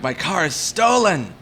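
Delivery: slow, indignant retold cry; mock outraged alarm. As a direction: replay the panicked realization, hammed up. Retold discovery of missing car.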